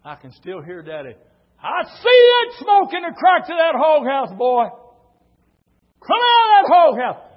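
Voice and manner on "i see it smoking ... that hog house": high, loud angry shout; yelling. Retold or imitated. Daddy yelling at his son hiding in the hog house. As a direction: bellow at the boy to come out.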